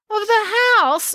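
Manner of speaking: very high, incredulous question; high-pitched astonished query; disbelieving exclamation. A narrator's reading. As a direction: blurt it in disbelief, pitch leaping up. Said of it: "exclaimed Finn disbelievingly".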